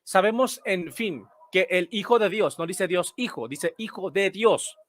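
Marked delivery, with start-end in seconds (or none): none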